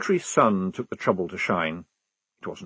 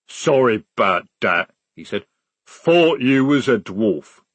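slow troll voice; 5 dB louder than usual. A narrator's reading. Troll speaks.

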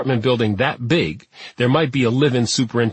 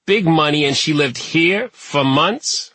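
slow, higher-pitched disbelief; incredulous; 5 semitones higher, slower than usual. A narrator's reading. Superintendent to detectives about rich tenant's cheap home, incredulous. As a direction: draw it out, rising in disbelief.

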